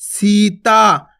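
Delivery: Sita with the vowels held long; drawn out. Shown as a demonstration. Teacher drawing the word out for children. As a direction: stretch Sita very slowly. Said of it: "खींच के उच्चारण करना".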